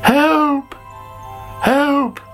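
high-pitched acted cries of distress; calling for help. Sammy feels ill, high calls for help. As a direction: call out high and urgent. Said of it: "called Sammy".

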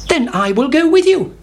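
high chirpy hen greeting. A narrator's reading. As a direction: greet like a bright little hen. Henny Penny agrees.

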